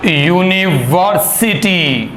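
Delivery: University drawn out very slowly and loudly, each syllable clear; slow dictation. Shown as a demonstration. Teacher slowly sounds out university for the children. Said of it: "ये मैंने कुछ word use किए हैं".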